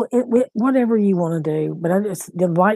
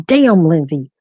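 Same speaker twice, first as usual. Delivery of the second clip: a loud, frustrated "Damn"; exasperated curse; 8 dB louder than usual. Real, not acted. Grandmother cursing at her unanswered call, exasperated. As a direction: snap at the daughter who won't answer. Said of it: "I'm fixing to start stressing out".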